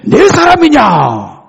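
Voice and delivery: loud, high-pitched shout of disbelief; shouted, astounded question; astonished king. Retold or imitated. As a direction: cry it out as a startled king. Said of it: "깜짝 놀랐어요".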